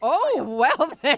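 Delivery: loud, high and slow in surprise; react, startled; surprised. Real, not acted. A loud noise on the phone line.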